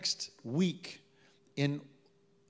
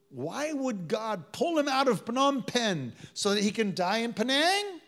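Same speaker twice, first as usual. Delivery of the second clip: higher and louder, incredulous; indignant rhetorical question; 8 semitones higher, 5 dB louder than usual. Real, not acted. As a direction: throw the absurd question at the crowd.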